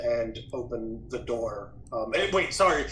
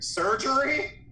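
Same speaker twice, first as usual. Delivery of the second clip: alarmed question; 12 semitones higher, 3 dB louder, slower than usual. Real, not acted. Reacting to door name.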